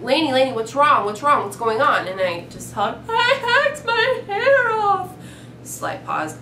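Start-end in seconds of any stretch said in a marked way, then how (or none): 0.0-2.1 urgent, louder worried questioning; worried friend. Retold or imitated. Friend answering her hysterical call, urgent worry. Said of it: "she's like". 3.1-5.1 high, slow mock wail; hysterical sobbing. Retelling her sobbing call to best friend, hammed-up hysterics. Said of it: "sobbing, crying, just hysterical".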